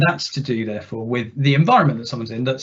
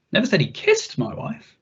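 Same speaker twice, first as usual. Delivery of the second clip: kissed hit much higher, rising; stress on kissed; 9 semitones higher than usual. Shown as a demonstration. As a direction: make kissed the point. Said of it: "see how the meaning shifts with a different emphasis".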